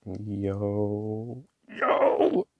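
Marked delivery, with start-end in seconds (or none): 1.7-2.5 high, loud excited yo; a sudden thrilled yo; excited yell. Real, not acted. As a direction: call out in real excitement. Excited at the ink's colour.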